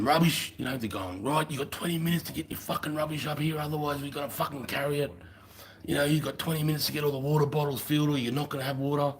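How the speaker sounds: high barked orders; mock officer barking orders; bossy officer. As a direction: mimic the officer ordering them.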